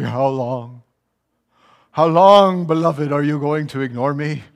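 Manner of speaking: mock clingy whine, rising loud on the second how long; whiny needy attitude. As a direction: play the needy boyfriend pleading, hammed-up. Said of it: "with this kind of an attitude and be like".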